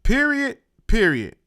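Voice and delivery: "Period" loud and high, then loud and dropped; mimicked "Period", hammered like a verdict; imitated emphasis. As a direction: echo his "Period" twice, punching both. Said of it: "He put emphasis on that shit".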